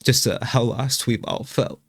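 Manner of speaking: holding back real tears, halting with long pauses; choked up, stopping and restarting; trying not to cry. Real, not acted. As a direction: fight to keep composure while speaking of a loss. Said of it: "I was trying not to cry".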